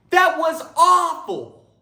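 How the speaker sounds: high, loud, slow outburst; yelled. Acted. Moviegoer yelling at the movie's end, high and loud. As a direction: bellow your disgust at the film. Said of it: "he yelled".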